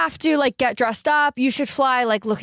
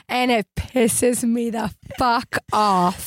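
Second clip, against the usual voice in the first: ordinary delivery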